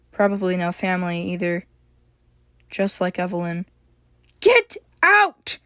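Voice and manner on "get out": loud, high angry "Get out!"; furious shout. A narrator's reading. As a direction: shout him out of the house. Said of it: "April snapped".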